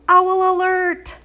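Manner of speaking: high, slow scream of alarm; screamed warning. A narrator's reading. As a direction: shriek it into the darkness. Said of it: "Carlos screamed out into the darkness".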